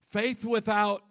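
usual speaking voice

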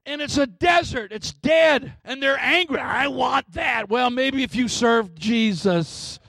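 high, impassioned pulpit cadence; full-force preaching. Real, not acted. Preacher declaring that God creates in dry places, fervent preaching. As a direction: climb into sustained, ringing proclamation.

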